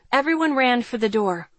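ordinary delivery, nothing marked

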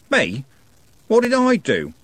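high, loud whiny protest; aggrieved protest. A narrator's reading. Algernon protesting to furious Trev, aggrieved. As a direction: protest your innocence.

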